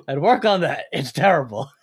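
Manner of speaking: a hint of a gruff cartoon boss; lightly voiced cranky cartoon crab; Mister Krabs voice. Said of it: "Mister Krabs goes".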